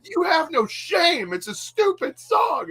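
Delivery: very high, slow, exasperated whine; mock irritated complaint. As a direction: imitate a fed-up friend, drawing it out high. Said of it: "he would be just so irritated".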